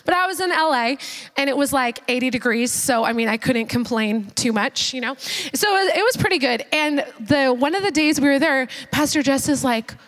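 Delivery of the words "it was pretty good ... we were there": usual speaking voice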